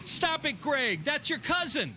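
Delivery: mock parent yelling at Greg; scolding mom shout. Acted pool party shout.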